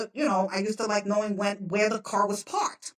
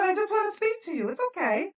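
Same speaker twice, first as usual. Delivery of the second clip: quick, high, placating tone; retold reassurance; 11 semitones higher than usual. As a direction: reassure them, pitched well up. Retold soothing of panicked woman.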